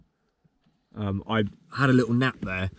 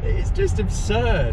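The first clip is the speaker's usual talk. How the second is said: excited; 13 semitones higher, 7 dB louder, much slower than usual. Real, not acted. Amazed after fast acceleration.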